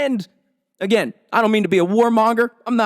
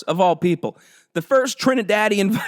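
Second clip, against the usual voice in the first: ordinary talk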